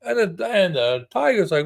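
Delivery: usual speaking voice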